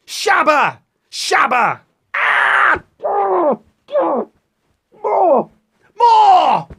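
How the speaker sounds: high, loud cries; mock shouting.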